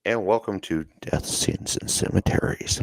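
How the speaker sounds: spooky host intro voice; creepy voice. Acted. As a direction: greet them like a creepy horror host. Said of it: "With the creepy voice".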